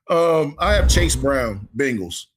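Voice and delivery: ordinary talk, nothing marked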